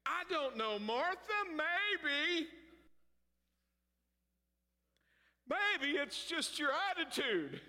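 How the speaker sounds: high, slow teasing of Martha. Acted. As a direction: needle Martha playfully.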